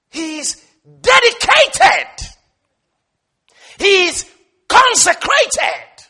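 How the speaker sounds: loud, high, slow shout; full-force preaching. Real, not acted. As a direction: drive each word up and out. Preaching climax.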